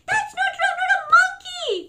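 high, shouted denial; mock outrage. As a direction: squeal in hammed-up protest. Reader protests the book's words.

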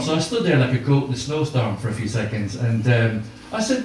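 normal talking voice

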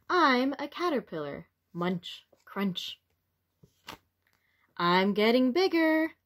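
lively, expressive picture-book read-aloud; reading to children. A narrator's reading. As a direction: read it to little ones with wonder.

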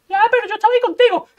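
high and fast boast; bragging inner voice. Acted. Inner voice brags to José about the combo. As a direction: crow about being right.

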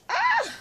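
high delighted squeal; a short high gasp of delight. Real, not acted. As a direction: squeal at something adorable. Seeing the cute book.

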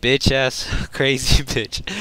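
slow, laughing through the words; half-laughing name-calling; laughing insult. Real, not acted. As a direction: chuckle it out in disbelief. Laughing insult while retelling stabbing.

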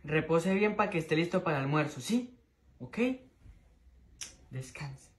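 very soft, low murmur; hushed bedtime voice. Acted. Son putting the chicken to rest, hushed. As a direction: lull someone to sleep, barely audible.

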